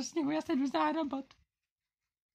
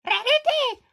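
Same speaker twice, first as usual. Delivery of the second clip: high, loud shout of her name; 7 semitones higher, 7 dB louder, slower than usual. Pretend. Locked out, yelling at Rarity's window, loud shout. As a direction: yell it up at the window. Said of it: "Já zakřičím před své okno".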